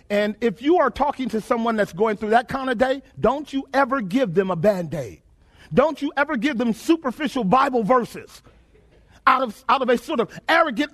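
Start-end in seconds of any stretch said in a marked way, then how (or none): none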